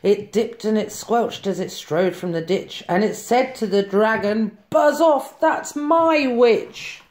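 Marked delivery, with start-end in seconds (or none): 4.7-7.0 high, slow beast threat; monster voice. A narrator's reading. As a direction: growl it out as a beast. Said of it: "a yowl and a growl and a croak and a shriek".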